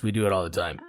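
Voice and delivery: low, put-on gravelly promise; mock deep voice. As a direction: drop the voice low for a joke. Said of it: "Only if you talk like that".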